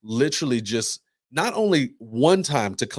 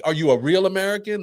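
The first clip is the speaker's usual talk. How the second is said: mock challenge; 8 semitones higher, 4 dB louder than usual. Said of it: "She's like".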